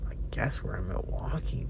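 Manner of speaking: hushed unvoiced aside; softly whispered resigned guess. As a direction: whisper it to yourself, deadpan. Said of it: "So I'm like".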